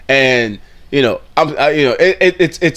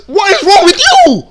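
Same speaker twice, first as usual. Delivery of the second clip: high squeaky mock of her; 5 dB louder than usual. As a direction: parrot her words in a squeal. Repeating clip in disbelief.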